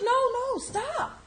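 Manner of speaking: high, loud panicked no no stop; urgent stop. Retold or imitated. Boyfriend yelling for her to stop the cart, high panicked shout. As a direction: cry out to make her halt. Anthony voiced, urgent in story.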